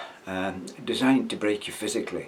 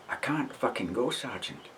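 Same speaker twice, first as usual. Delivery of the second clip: softer, pleading refusal; frightened recruit; 3 semitones higher, 7 dB quieter than usual. Retold or imitated. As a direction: recruit frozen at the door, hushed refusal. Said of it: "he says".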